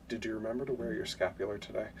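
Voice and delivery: quick, soft check-in as a big brother; lightly voiced older brother, asked fast and quietly; fussing older brother. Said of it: "the kind of older brother that's like".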